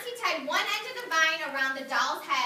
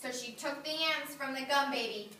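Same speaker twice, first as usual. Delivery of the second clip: usual speaking voice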